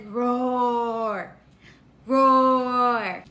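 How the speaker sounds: low, drawn-out mock roar; lion roar. Teacher recapping the lion's sound for a toddler, low playful roars. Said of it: "And a lion says".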